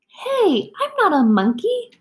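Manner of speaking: high, loud protest; indignant protest. Pretend. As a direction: insist you're not the monkey. Reader protests the text.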